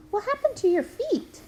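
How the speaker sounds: put-on high, childlike curious question; little girl asking about his missing feet. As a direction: ask like a small girl puzzled. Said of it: "Evelyn pointed at them".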